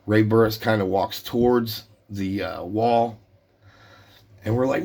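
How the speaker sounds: normal talking voice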